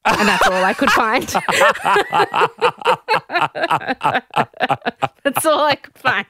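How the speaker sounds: cracking up mid-sentence; helpless laughter breaking around the words; laughing. Real, not acted. Host amused by the clip she found, laughing. Cracks up at her own quote.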